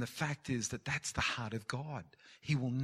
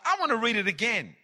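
usual speaking voice

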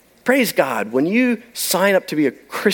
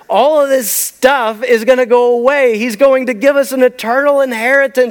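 loud, high, rousing sermon climax; full-force preaching; 8 semitones higher, 4 dB louder than usual. Real, not acted. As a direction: proclaim the promise with rising fervour.

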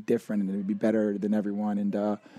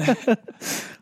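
high laugh; 12 semitones higher, 3 dB louder than usual. Real, not acted. Guest laughs at greeting.

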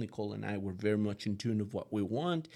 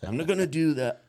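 higher, louder mock teenager brushing dad off; louder mock teenage sulk; eye-rolling kid; 5 semitones higher, 9 dB louder than usual. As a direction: imitate a kid rolling their eyes. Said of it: "they roll their eyes at you like".

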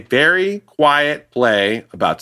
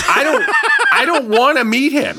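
panicked; 11 semitones higher than usual. Retold or imitated. Hiding from Malkovich in panic.

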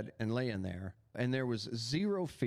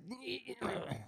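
choked, higher failed attempt at a word; strangled throat noise; 10 semitones higher, 7 dB quieter than usual. Man showing his attempt to say Jesus, choked noise. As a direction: strain out a sound from a held throat. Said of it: "that's all I could get out".

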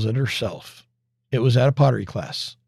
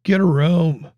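curt; 5 semitones higher, slower than usual. Retold or imitated. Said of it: "he curtly tells them".

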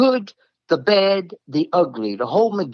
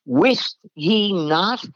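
slow scripture quote; 5 semitones higher, much slower than usual. Acted. Quoting archaic scripture line.

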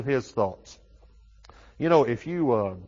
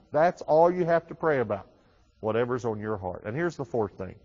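ordinary delivery

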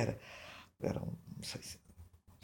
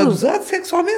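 laughing; 11 semitones higher, 5 dB louder than usual. Real, not acted. Laughing through shocking answer.

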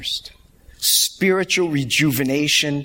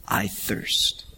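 soft, lower, near-whispered; faint dying words; 6 semitones lower, 6 dB quieter than usual. Retold or imitated. Jesus on the cross, dying, whispering faintly. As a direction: barely breathe it out.

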